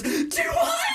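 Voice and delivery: high, loud delighted squeal with a laugh. Retold or imitated. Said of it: "And she goes".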